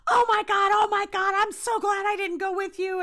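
high, loud panicked gush; freaking out. Retold or imitated. Anna in the back seat hears about the ghost, freaking out loudly. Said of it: "she's freaking out".

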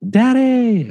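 put-on very high, drawn-out child's call; imitated excited kids. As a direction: squeal it like kids greeting their dad. Imitates kids greeting him at door.

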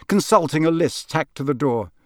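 plain reading voice

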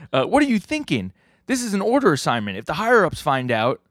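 high-pitched, agitated retelling; alarmed objection. Retold or imitated. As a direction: protest in a high, worried voice.

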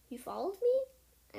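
high, loud, slow startled question. Acted. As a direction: caught off guard, disbelieving. Startled at being followed.

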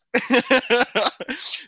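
high, loud peal of laughter. Real, not acted. Storyteller laughing at own impression, loud cackle. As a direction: crack up after the impression.

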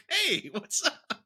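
high, drawn-out goofy greeting; high-pitched mock-casual impression; Luffy. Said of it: "that's what Luffy would do".

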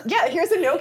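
high laughing mock hand-over. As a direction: laugh while offering the phone.

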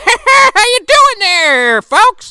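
put-on high, loud, drawn-out old man voice; Gramps. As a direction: holler a slow greeting as a cartoon grandpa. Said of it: "Gramps was fucking cooking it up".

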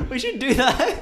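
laughing about making joke t-shirts. Real, not acted. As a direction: giggle it out. Laughing over t-shirt idea.

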